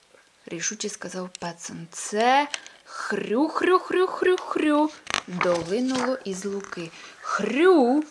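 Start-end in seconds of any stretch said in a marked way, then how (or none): 1.9-4.9 high, very slow piggy oinks; pig grunting. Acted. Pig grunting heard from the meadow. 7.2-8.0 pig grunt. Acted. Pig grunting heard from the meadow.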